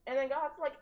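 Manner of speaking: usual speaking voice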